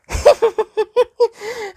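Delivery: giggles way up high; a girlish, teasing giggle. Acted. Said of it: "She giggled".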